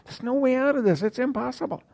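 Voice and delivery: high, strained voice of someone who sees no way out; a believer facing an army, voiced high and despairing. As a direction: voice the doubter who has given up. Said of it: "you look at your situation and you think".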